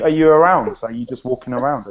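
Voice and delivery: ordinary delivery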